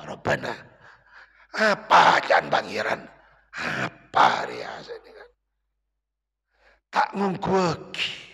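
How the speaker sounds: ordinary talk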